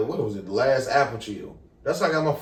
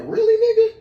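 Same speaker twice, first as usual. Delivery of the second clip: squeaky-high, louder retold disbelief; incredulous; 5 dB louder than usual. Young man at fingerprinting, retold incredulous question. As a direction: voice leaps up in mock disbelief.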